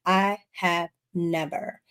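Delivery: ordinary talk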